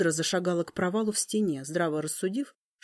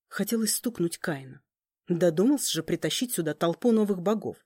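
plain reading voice

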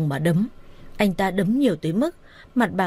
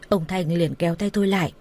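ordinary delivery

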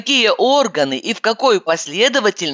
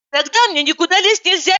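sharp retort; 11 semitones higher, faster than usual. A narrator's reading. Narrator's quick retort.

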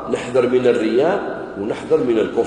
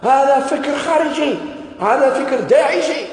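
indignant officials; 11 semitones higher, slower than usual. Retold or imitated. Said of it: "قالوا له".

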